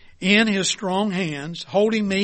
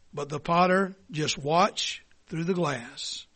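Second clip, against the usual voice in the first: ordinary talk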